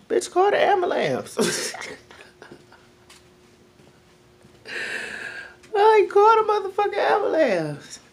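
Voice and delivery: high-pitched, urgent disbelief; alarmed urging. Retold or imitated. Telling the sick neighbor to call the ambulance, high and alarmed. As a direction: push her to call for help. Urging friend to get help.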